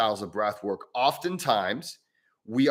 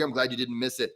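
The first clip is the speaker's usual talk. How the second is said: usual speaking voice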